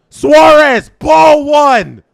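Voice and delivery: loud, frustrated call of the pitch; exasperated shout. Real, not acted. Fan calling yet another ball, loud exasperation. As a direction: bark the count in despair.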